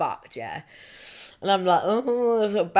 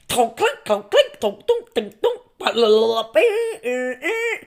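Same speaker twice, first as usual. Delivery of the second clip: clanking mouth-made techno beat, leaping high; imitated techno noises; 3 semitones higher, 4 dB louder, slower than usual. Showing co-host [name] vibes, mouthed techno noises. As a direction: bounce between mock electronic bleeps and clanks. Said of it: "like [name] vibes".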